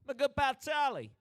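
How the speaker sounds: loud, high New Jersey accent. Shown as a demonstration. Hailing the mascot as a pal, New Jersey accent. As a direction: holler it like a New Jersey local. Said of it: "in New Jersey you don't say".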